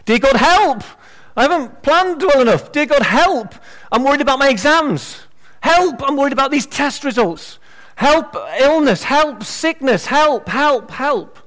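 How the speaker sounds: high, frantic cries of help; a worried person's desperate help-me prayer; panicked crisis prayer. Retold or imitated. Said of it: "most of your prayer life will be crisis-driven".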